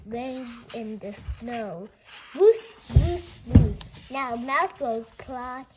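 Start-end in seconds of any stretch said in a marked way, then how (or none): 2.4-3.8 loud playful barking; dog barks. Pretend. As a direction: bark out for the dog in the story. Dog barking in picture book.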